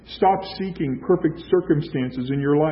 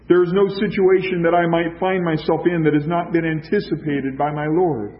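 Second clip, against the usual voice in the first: ordinary delivery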